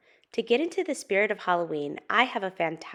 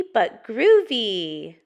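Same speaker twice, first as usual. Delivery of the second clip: slow, loud, stretched groovy; cool cat savouring it; groovy Pete; 7 dB louder, much slower than usual. Acted. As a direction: draw the word out with relish. Pete approves in story.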